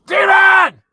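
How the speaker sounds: one high, loud screamed word; furious shout. Real, not acted. Man screaming at the bird mid-rant, high loud yell. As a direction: blast it at the bird.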